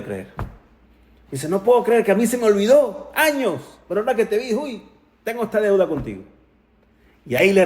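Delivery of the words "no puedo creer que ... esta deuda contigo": can't believe he forgot, bursting out; excited disbelief. Retold or imitated. Rabbi suddenly remembering his debt to Jacobson, excited.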